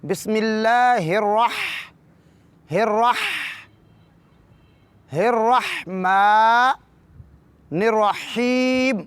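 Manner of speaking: very slow, drawn-out الرحمن with the ح from mid-throat; slow recitation demo. Shown as a demonstration. Teacher slowly demonstrating the throat letter to students, drawn out. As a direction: stretch each syllable, breaking off at الرح to show the sound. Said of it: "وسط حلق سے ادا کرتے ہوئے".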